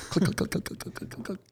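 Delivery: quiet, voiceless click-click-click; imitated calculator clicking. Co-host joking about putting the calculator away, imitated clicking. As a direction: mimic rapid key taps under your breath.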